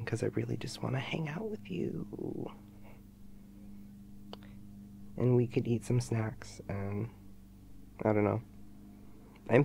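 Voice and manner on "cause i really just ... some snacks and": soft unvoiced whisper; intimate whisper. Real, not acted. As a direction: whisper it close to the mic. Softly wooing boyfriend.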